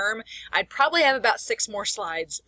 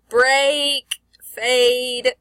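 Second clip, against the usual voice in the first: "Break" and "fade" each held long, higher and louder; drawn-out rhyme demo; 4 semitones higher, 6 dB louder than usual. Shown as a demonstration. Teacher testing a near-rhyme, "Break" and "fade" stretched out. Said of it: "kinda rhymes a little bit".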